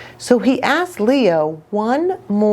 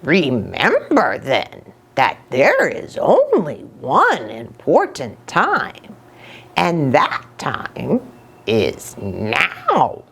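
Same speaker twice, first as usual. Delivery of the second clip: slower, lower storytelling voice for the lesson; story moral; 7 semitones lower than usual. A narrator's reading. Storyteller delivers closing moral.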